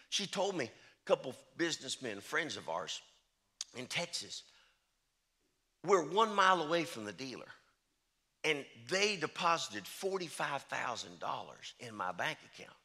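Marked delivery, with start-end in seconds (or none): none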